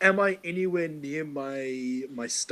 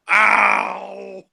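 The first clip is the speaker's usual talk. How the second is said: drawn-out, high cry of pain; loud acted howl of hurt; very loud yell; 4 semitones higher, 10 dB louder than usual. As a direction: holler a long pained ow. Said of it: "I'm yelling it very loudly".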